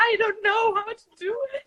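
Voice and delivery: high, loud, helpless protest; mock helpless wail. Flustered actor answering boyfriend's complaint, mock helpless wail.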